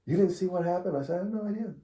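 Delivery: high, baffled disbelief; pitcher, clueless after the fuss, high and baffled. Retold or imitated. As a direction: play it totally clueless. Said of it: "I'm like".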